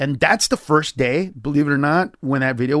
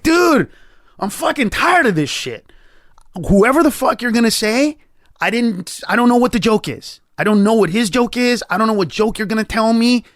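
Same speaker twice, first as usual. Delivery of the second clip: exasperated outburst; 7 semitones higher than usual. Retold or imitated. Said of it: "And I was like".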